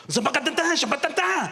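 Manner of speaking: high, rapid-sounding mock gibberish; foreign-speaking ride attendant. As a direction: imitate unintelligible foreign chatter up high. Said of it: "turns to his mate and goes".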